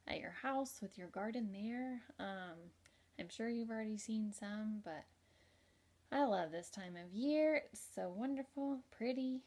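ordinary delivery

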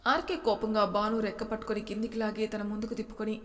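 ordinary delivery, nothing marked

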